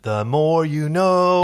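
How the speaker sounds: slow, drawn-out announcer tag; mock PSA slogan. Real, not acted. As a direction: deliver it like a TV info spot. Jokey slogan quote, slow.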